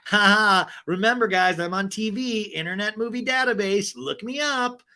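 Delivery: high, showy self-promotion; mock boast with a fake laugh up front; Heather Dubrow. Host mocking a sitcom actress, showy boasting. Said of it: "which is a very Heather Dubrow thing of like".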